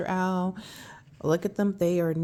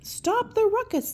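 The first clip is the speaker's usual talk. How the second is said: high squeaky squirrel; 13 semitones higher than usual. Acted. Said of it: "said the squirrel".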